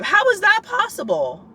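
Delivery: squealing high disbelief; incredulous. Real, not acted. Comparing lab to anatomy, incredulous high squeal. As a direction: shoot way up on "How".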